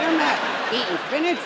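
ordinary talk

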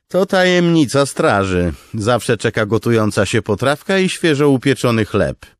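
loud, high cheerful lieutenant; jovial boast. Acted. Noro bragging to Kaladin about the guard's food, loud jovial. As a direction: brag about the stew.

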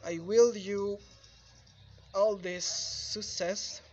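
ordinary delivery

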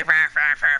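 loud "rah rah" dog bark; mock barking.

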